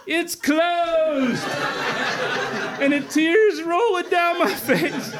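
high-pitched, laughing through the words. Real, not acted. Telling the punchline of the fishing story, laughing. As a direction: crack up while telling it.

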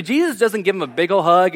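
ordinary talk

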